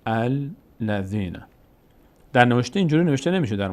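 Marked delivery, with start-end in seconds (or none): 0.0-1.4 الذین stretched out very slowly; syllable-by-syllable demonstration. Shown as a demonstration. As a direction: teach الذین one drawn-out piece at a time. (آهسته، کشیده و شمرده)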